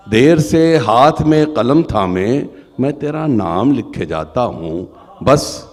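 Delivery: loud, measured poem recital; stately nazm recitation. Acted. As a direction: declaim each line to the gathering. Recites his nazm at mushaira.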